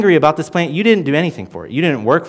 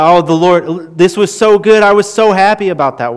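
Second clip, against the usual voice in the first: higher and louder, a mock whiny complaint; mock upset complaint; 6 semitones higher, 7 dB louder than usual. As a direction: whine like someone who lost a favourite thing. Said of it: "get upset when the Lord takes things away".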